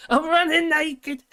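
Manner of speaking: high, slow, loud comic shout; hammed-up movie quote yell; Will Ferrell quote. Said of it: "He did it".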